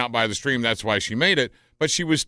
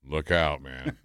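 a lightly voiced gruff warning, much lower and softer; mock warning; 11 semitones lower, 4 dB quieter, slower than usual. Radio host teasing co-host about math, low mock warning. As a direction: warn him off your turf, low and teasing.

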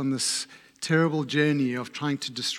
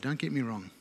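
ordinary delivery

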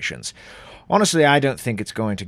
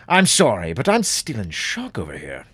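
usual speaking voice

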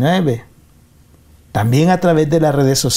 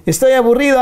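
high, whiny bored complaint; bored whine; 11 semitones higher, 3 dB louder, faster than usual. Acted. Radio host acting out a bored listener, high whiny complaint. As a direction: whine it like a restless kid with nothing to do.

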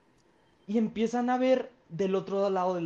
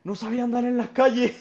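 voz citada más aguda y fuerte; pregunta imitada de la señora; 6 semitones higher, 6 dB louder than usual. Retold or imitated. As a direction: imita la pregunta subiendo tono y volumen. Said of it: "Hace la pregunta". (pregunta citada, aguda y fuerte)

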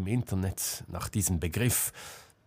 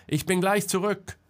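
high, raised call; calling back; 10 semitones higher, 4 dB louder than usual. A narrator's reading. Selma reassuring Marcel from afar, calling out. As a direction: toss back a quick shouted promise. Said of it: "rief Selma".

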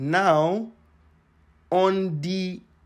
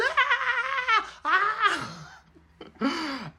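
high joyful cries; excited shout. Retold or imitated. Alone that night after the offer, high joyful cries. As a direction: cry out in disbelief. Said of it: "I was shouting that night".

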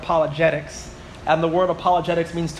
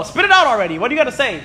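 higher, exasperated push for an answer; mock impatient outburst; impatient demand; 6 semitones higher, 4 dB louder, faster than usual. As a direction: act out losing patience with a stalling talker. Acting out an impatient listener.

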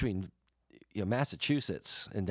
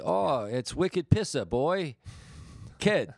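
loud, high, hammed-up Boston caricature; over-the-top, stretched Boston accent; exaggerated Boston accent; 8 semitones higher, 4 dB louder, much slower than usual. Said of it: "such an oversimplification of it".

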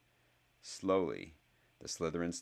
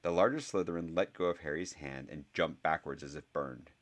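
ordinary delivery

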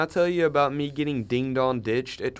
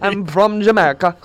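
put-on Jamaican accent; 6 semitones higher, 9 dB louder than usual. Mimicking Mario Mighty.

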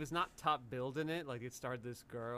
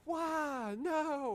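high mock shock; 14 semitones higher, 4 dB louder than usual. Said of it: "he would have been like".

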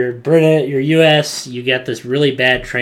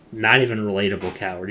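normal talking voice

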